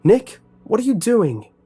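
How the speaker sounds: high, loud shout after him. A narrator's reading. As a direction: yell after the fleeing friend. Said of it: "I shouted".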